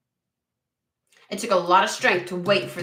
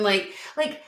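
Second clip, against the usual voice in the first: usual speaking voice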